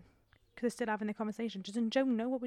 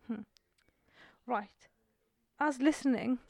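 normal talking voice

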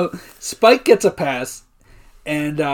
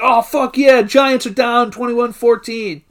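cheering; 5 semitones higher, slower than usual. Retold or imitated. Everybody thrilled at the score, mock cheering. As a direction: announce it like a pumped-up fan.

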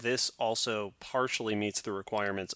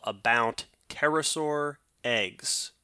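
ordinary talk

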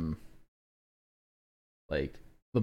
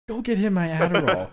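shouted mock command, much higher and louder; Erlich's order; 10 semitones higher, 8 dB louder than usual. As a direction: imitate a TV bully yelling at a kid. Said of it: "just slaps the shit out of this kid".

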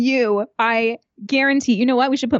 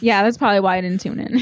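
ordinary talk

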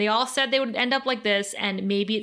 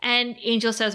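normal talking voice

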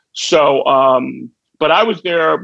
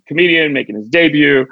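ordinary delivery

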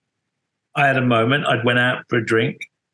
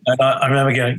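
ordinary delivery

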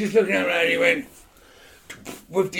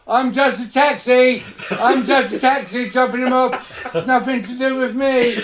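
panicky taxi driver; 7 semitones higher, 4 dB louder than usual. Retold or imitated. Cab driver jumping out at the police, higher panicky plea. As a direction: plead like a scared cabbie. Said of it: "he jumped out of the car after me and went".

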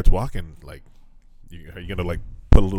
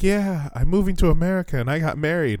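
high, light mock girl voice; imitating her; 9 semitones higher than usual. Retelling the match announcing her marriage, high mock voice. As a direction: lift the voice to play her chirpy news. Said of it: "And she's like".

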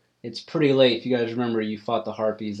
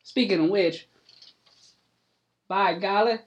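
high, loud country voice; an old farmer happy to get his hat back; hillbilly farmer, delighted; 7 semitones higher, 5 dB louder than usual. Acted. GM voices Cletus Ray.

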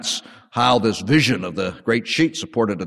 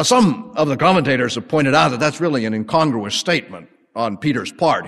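ordinary delivery

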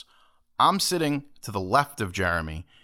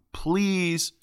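high, drawn-out polite plea; overtly nice; 7 semitones higher than usual. Shown as a demonstration. Moviegoer warns what his politeness to a stranger means, overtly nice. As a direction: lay the courtesy on thick. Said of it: "if I'm overtly nice to you".

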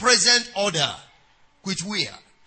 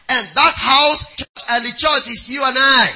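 preaching shout; 3 semitones higher, 5 dB louder than usual. Acted. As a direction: call it out to the congregation. Preaching at full force.

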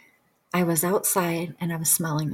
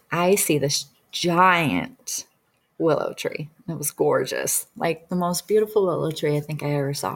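ordinary delivery

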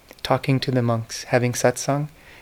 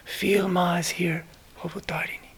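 reverent whisper; 4 semitones higher, 6 dB quieter than usual. Retold or imitated. Guru whispering his prayer to the Divine Mother, reverent whisper. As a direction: whisper the holy name as if in prayer. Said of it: "I remember he would say".

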